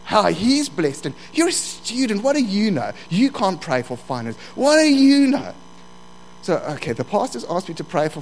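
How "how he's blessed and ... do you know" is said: devil's accusations, building; devil's taunting. Acted. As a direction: taunt rising in pitch, punching the last question. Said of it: "the devil says".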